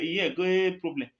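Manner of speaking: ordinary delivery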